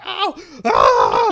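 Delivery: hammed-up ow and shout; mock pained yelp. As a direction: imitate being hurt, overplayed. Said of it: "I was like".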